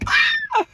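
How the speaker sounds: short, very high, loud yelp; exasperated scream. Real, not acted. Daughter reacting to mom's bluntness, high exasperated scream. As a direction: scream out in comic disbelief. Incredulous outburst at mother's remark.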